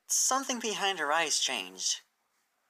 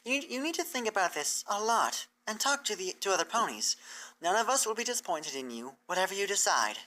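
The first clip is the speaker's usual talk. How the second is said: raised, earnest teacher pony explaining; bright scholarly mare, raised pitch; Twilight's voice; 7 semitones higher than usual. Acted. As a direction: lay out the plan to her hopeful and clear. Twilight reassures Applebloom.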